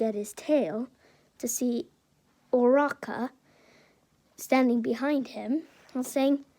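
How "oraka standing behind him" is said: plain reading voice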